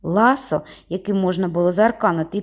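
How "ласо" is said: ordinary delivery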